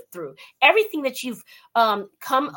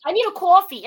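higher, louder put-on New York voice; New York accent; 7 semitones higher, 7 dB louder than usual. Host retelling ordering habit, hammed-up New York accent. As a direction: lean hard on a New York "cawfee". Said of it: "it rubs off when you spend any time in New York".

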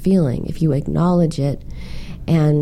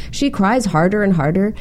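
ordinary talk

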